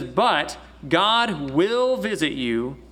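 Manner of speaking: WILL stressed and drawn out; slow, weighty stress on "will"; emphatic. Retold or imitated. Joseph assuring his brothers before he dies, emphatic. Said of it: "it's a very emphatic thing".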